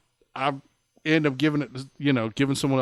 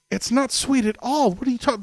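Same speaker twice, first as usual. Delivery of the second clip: high disbelieving protest; can't believe what he's hearing; higher, exasperated disbelief at the engineer; incredulous; 10 semitones higher than usual. Retold or imitated.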